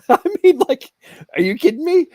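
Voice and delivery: scoffing laugh then outraged question; incredulous laugh. Real, not acted. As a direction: laugh it off in disbelief. Outrage at judge's ruling.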